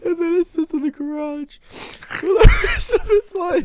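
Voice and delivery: high, loud words squeezed through laughter; laughing hard. Real, not acted. Joining in the joke about the garage, laughing hard. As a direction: barely get it out for laughing. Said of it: "What are you guys both laughing so hard for?".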